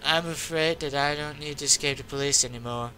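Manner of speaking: ordinary talk, nothing marked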